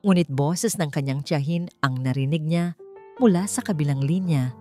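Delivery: ordinary delivery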